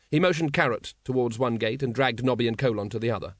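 ordinary delivery

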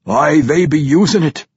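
loud, high dwarf brogue, boasting; vampire dwarf bragging to his king; boastful vampire dwarf. Acted. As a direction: crow about the kills like a wild dwarf. Pwent answers about forge.

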